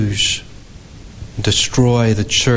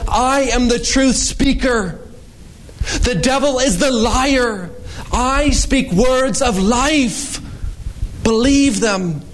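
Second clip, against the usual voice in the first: preaching at full force; 11 semitones higher than usual. Acted. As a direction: declare it over the congregation. Quoting Jesus in high preaching voice.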